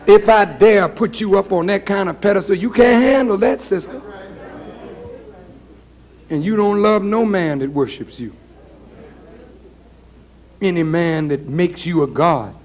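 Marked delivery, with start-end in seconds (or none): none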